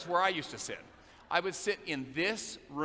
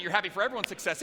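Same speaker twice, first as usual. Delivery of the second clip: ordinary delivery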